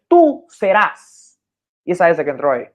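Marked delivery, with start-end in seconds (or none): 0.1-1.4 "Tú serás" drawn out slowly, louder, leaning on the S; slow, louder teacher demo of "Tú serás" with the added S. Shown as a demonstration. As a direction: stretch "serás" slowly so its final S is heard. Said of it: "Cuando tú dices".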